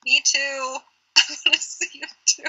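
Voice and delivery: normal talking voice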